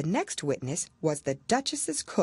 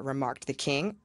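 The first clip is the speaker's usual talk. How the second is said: usual speaking voice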